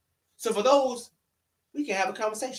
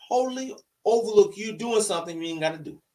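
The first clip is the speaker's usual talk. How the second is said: normal talking voice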